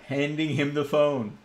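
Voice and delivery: normal talking voice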